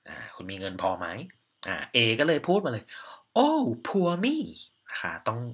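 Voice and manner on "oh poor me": high, squealing "Oh" sweeping upward; little exclamation. Shown as a demonstration. Teacher showing students a playful high exclamation. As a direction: gasp "Oh, poor me" in mock dismay. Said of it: "อุทานนิดนึงนะ".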